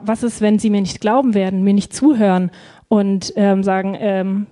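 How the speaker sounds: normal talking voice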